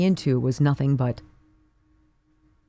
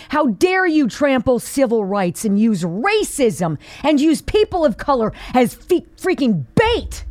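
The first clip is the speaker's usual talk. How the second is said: furious tirade; 5 semitones higher than usual. Real, not acted. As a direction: hammer each "how dare you" in real outrage. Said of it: "I am furious".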